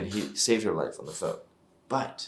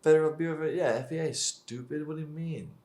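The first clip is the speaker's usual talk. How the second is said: ordinary talk, nothing marked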